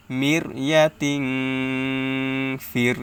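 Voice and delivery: long nasal hum ending "ইয়াতিং" before "ফির"; slow, drawn-out ng in "ইয়াতিং", then on to "ফির"; ghunna. Shown as a demonstration. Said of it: "এভাবে গুণনা করতে হবে". ("ইয়াতিং"-এ টেনে গুন্নাহ করে "ফির")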